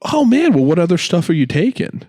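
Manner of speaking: high, eager salesman pitch; mock salesman. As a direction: lay on the pushy charm. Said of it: "was like".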